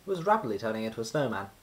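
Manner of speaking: ordinary delivery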